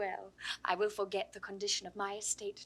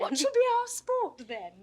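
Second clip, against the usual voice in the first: eager question; 11 semitones higher, 5 dB louder than usual. Acted.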